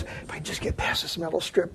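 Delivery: a hushed inner whisper, nervous; whispered thought. Retold or imitated. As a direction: breathe it as a secret thought near the exit.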